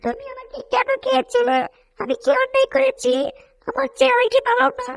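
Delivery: very high, soft chirpy reproach; a small bird asking why it was eaten; little bird voice. Acted. Bird's voice accusing the cat of eating it, high and plaintive.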